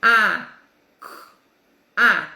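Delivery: a, k, a said as separate isolated letter sounds; sounding out phonemes. Shown as a demonstration. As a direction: sound out each letter with pauses. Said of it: "o som de cada letrinha".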